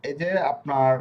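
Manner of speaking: ordinary talk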